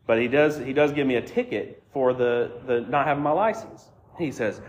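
normal talking voice

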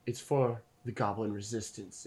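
low, soft in-character declaration; solemn goblin rebel. Acted. Goblin woman explaining her cause to travelers, solemn. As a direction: declare the cause quietly and gravely. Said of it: "she looks at you and she goes".

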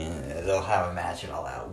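ordinary talk

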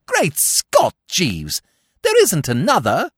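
high, loud, flabbergasted outburst; astonished exclamation. Acted. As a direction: exclaim in shocked disbelief. Bertie shocked by Jeeves's revelation.

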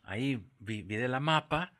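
usual speaking voice

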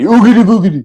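high, loud spooky boo chant; spooky ghost cry. Retold or imitated. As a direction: ham up a ghostly oogity boogity. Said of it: "make sure you don't say".

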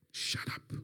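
stern voiceless whisper in short bursts; whispered command. Retold or imitated. Mother silences her frightened son, stern whisper. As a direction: hush him with a firm whisper. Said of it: "heard the whispers".

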